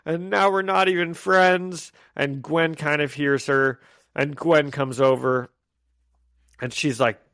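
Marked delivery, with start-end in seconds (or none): 0.1-1.9 higher, tearful mock sob; imitating crying MJ. MJ crying to Peter over the breakup, higher tearful voice. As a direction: voice MJ near tears with regret. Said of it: "she kinda starts crying".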